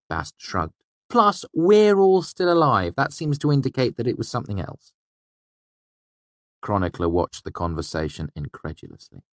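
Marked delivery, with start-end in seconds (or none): 1.1-4.9 put-on higher, lively young trickster voice; young fey man voice. A narrator's reading. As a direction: voice Bast higher, quick and sly. Bast voiced by narrator.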